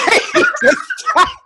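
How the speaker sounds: very high squealing laugh. Real, not acted. Laughs at team names.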